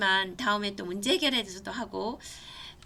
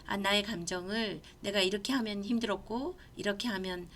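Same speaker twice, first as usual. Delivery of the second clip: ordinary talk, nothing marked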